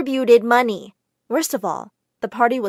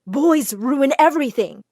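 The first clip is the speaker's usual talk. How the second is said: louder scolding outburst; angry yelling; 3 semitones higher, 5 dB louder than usual. A narrator's reading. Widow barging in on the girl and boy, yelling. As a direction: storm in and tell her off. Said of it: "She started yelling at me".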